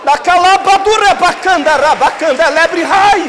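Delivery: loud, high ecstatic tongues; speaking in tongues. Real, not acted. Pastor bursts into tongues before the church, loud and ecstatic. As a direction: pour out tongues in full fervor.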